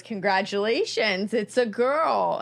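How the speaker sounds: loud, drawn-out proud announcement; announcing. Retold or imitated. Doctor announcing the baby is a girl, loud and slow. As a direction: boom it out like revealing a surprise.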